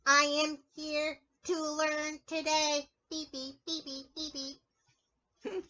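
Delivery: slow robot speech then beeps. Pretend. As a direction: talk like a toy robot. Acting as a robot.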